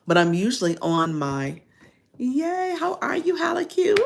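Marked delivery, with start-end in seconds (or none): 2.2-4.0 high, loud delighted hello to a friend; excited greeting. Real, not acted. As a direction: squeal a happy hello to a chat friend. Greeting a viewer in chat.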